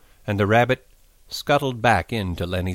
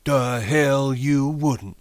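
slow, higher jeering voice; scornful rabbit; 5 semitones higher, much slower than usual. A narrator's reading. Rabbit contradicting Lenny, slow scorn. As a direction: drag out the contemptuous comeback.

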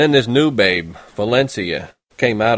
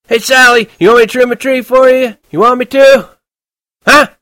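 put-on drunken shouting; 10 dB louder than usual. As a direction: slur it loud into the phone, very drunk. Drunk Holden.

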